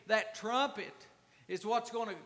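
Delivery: ordinary talk, nothing marked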